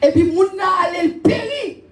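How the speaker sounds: full-voiced exhortation to the church; fervent preaching. Real, not acted. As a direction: preach it out over the congregation. Preaching to congregation.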